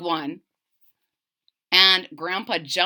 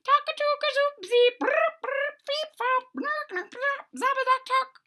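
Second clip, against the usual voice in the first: very high chirping nonsense bird calls again squeaked; high piping bird chatter; strange bird calls. Acted. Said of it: "I was making the bird sounds".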